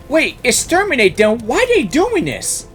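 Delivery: usual speaking voice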